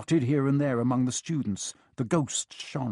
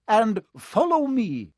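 much higher, louder, slow; stern professor; 11 semitones higher, 6 dB louder, slower than usual. A narrator's reading. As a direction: lead them off firmly. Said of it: "Professor McGonagall told the first years".

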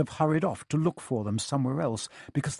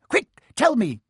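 high, loud, impatient order; urgent demanding caretaker; 11 semitones higher, 7 dB louder than usual. A narrator's reading. Filch pressing Peeves for the pupils' route, urgent. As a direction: demand it sharply, raised and urgent.

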